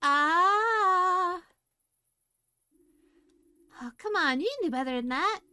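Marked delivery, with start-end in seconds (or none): 0.0-1.4 horrified scream. Acted. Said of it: "voice break into a horrifying scream".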